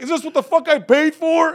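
high loud mock heckler yell; shrieking high heckler complaint; angry heckler. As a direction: yell it like a ripped-off customer.

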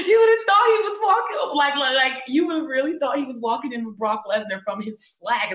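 high, loud laughing retelling; can't stop laughing as you tell it; giggling through the story. Real, not acted. Laughing while telling story.

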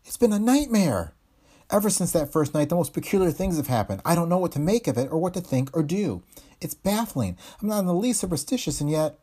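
high, tense and shaken; frightened. Acted. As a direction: pour it out, still scared. Frightened wife tells of nightmare.

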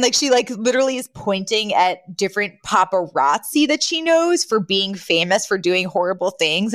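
normal talking voice

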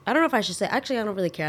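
ordinary talk

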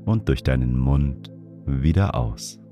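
guided meditation. Acted. Leads a breathing meditation.

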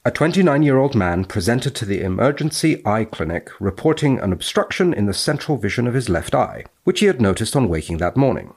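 crisp academic recitation; formal read-aloud. Acted. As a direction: read it out like the journal text. Reading the case report aloud.

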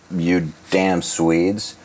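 ordinary talk

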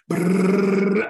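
a loud rolling brrr for the projector going over; imitated projector crash. Teammate retelling how he kicked the projector over, loud brrr noise. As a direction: mimic the crash, loud and buzzing.

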